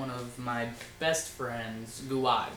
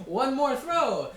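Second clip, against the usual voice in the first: mock cheeky self; 9 semitones higher, 5 dB louder, slower than usual. Retold teen self, playful.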